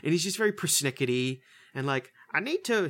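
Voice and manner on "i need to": higher-pitched attempt at an Australian accent; mock Australian accent. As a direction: start into a put-on Australian voice. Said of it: "he's, he's Australian".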